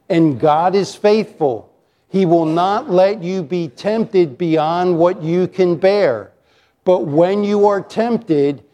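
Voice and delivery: slow, deliberate scripture reading. Real, not acted. Preacher leading the congregation reading together, slowly. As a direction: read it out measured, phrase by phrase. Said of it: "I'm gonna read it slow".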